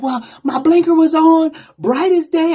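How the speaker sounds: indignant woman driver. Retold or imitated. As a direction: snap back at him, pitched high and loud. Angry driver defends herself.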